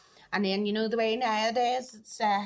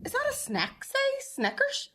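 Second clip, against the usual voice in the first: high, slow disbelief; disbelieving; slower than usual. Retold or imitated. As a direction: squeak it out in shock. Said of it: "I went".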